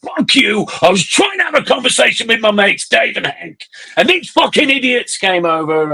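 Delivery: high-pitched, rushed protest, re-enacted; retold angry retort. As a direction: act out snapping back at someone shouting. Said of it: "And I'm like".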